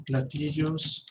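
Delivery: very slow platillos; slow spelled-out word. Shown as a demonstration. Teacher saying platillos slowly to students. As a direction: stretch out platillos syllable by syllable. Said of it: "¿cómo se dicen platillos?".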